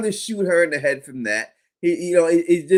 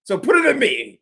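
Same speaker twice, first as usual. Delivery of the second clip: higher, louder eager offer; heroic plea; 8 semitones higher, 4 dB louder than usual. Retold or imitated. Kenny offering to take the worms, eager higher plea. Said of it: "now he wants to be the hero".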